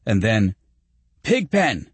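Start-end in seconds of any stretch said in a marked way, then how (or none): 1.2-1.9 high, excited call; exclaiming. A narrator's reading. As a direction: cry out on spotting a favourite star. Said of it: "Greg exclaimed".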